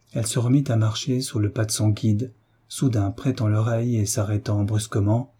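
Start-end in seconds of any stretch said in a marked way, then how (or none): none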